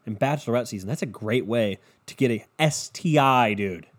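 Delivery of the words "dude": ordinary delivery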